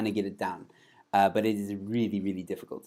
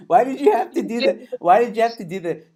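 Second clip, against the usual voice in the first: high, loud mock complaint; loud and pitched up, cracking up; amused protest; 10 semitones higher, 10 dB louder than usual. Real, not acted. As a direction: blurt it out, real exasperated amusement. Laughing protest at the silly voice.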